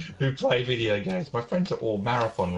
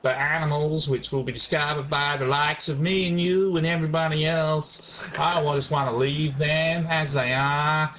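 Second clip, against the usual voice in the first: put-on amateur American accent, stretched out. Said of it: "do that in your American accent".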